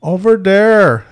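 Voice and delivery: loud, high, drawn-out mock Native accent. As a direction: stretch it out in an exaggerated Native accent. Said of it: "we don't fucking talk like that".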